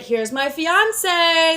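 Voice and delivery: drawn-out, high show-off reveal; mock announcement. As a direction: stretch it out high, like presenting a prize. Mock announcement of a future fiance.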